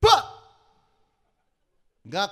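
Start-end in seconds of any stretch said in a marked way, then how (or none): 0.0-0.2 abrupt loud smack noise; one short explosive impact sound; imitated crash. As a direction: hit it like a body landing. Imitates the impact after the fall.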